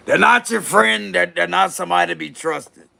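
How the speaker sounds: put-on warning voice. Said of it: "I'm gonna have to use that voice".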